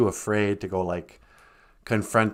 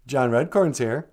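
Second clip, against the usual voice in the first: ordinary delivery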